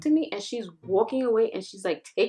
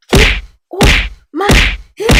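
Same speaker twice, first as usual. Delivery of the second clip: much higher, much louder yell; 11 semitones higher, 15 dB louder than usual. Retold or imitated. Girl yelling at the hair puller, loud and high. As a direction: shout it like someone's yanking your hair. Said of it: "I'm like".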